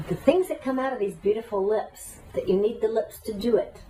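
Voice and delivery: usual speaking voice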